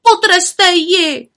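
high, loud, slow call; witches calling out. A narrator's reading. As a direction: cry it out together. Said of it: "volaly i všechny ostatní".

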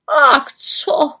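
high, loud dismissive raven cry; raven's exclamation. A narrator's reading. Raven cries out dismissing her seven skirts. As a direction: brush it off with a cry. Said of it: "vykřikl Havran".

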